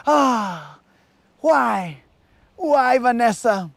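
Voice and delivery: mock soap-opera despair, overdone; dramatic lament. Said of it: "you do the dramatic".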